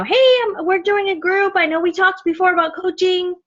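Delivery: high, chirpy message voice; upbeat invite. Shown as a demonstration. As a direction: pitch it up like a friendly group invitation.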